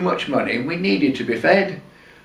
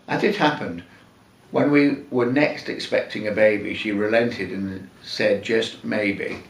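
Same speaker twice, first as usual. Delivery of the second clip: ordinary delivery, nothing marked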